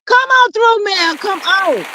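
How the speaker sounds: high, drawn-out call; eager high call to Mel to come on. Real, not acted. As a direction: sing it out to pull her in. Calling Mel into the live.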